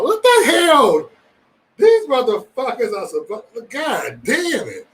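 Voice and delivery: loud, high, drawn-out holler; exasperated outburst. Real, not acted. Moderator reacting to chat trouble, exasperated outburst. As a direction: stretch each curse out in loud disbelief.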